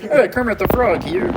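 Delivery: puppet frog greeting; comic frog-puppet impression; Kermit the Frog. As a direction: put on a cartoon frog voice. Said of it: "He sounds like Kermit".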